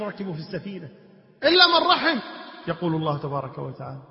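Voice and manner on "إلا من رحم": high, loud emphatic repeat; impassioned preaching. Acted. Preacher stressing who alone is saved, impassioned. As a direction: drive the exception home.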